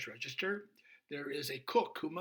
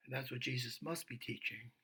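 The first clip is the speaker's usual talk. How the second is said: ordinary talk, nothing marked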